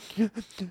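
mock hysterical catch of breath; imitated sobbing gasp. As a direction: gasp like a kid crying too hard. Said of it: "he was crying hysterically".

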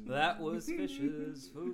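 slow, high announcer sign-off; show host sign-off. Acted.

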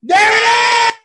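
high, loud "there it is"; excited shout. Real, not acted. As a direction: shout it out in delight.

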